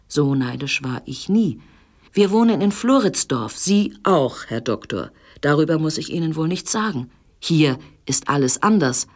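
ordinary delivery

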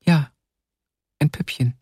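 put-on small, high child's voice; timid childlike reply; shy little girl. A narrator's reading. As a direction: answer shyly, like a little girl. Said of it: "antwortete das Mädchen schüchtern".

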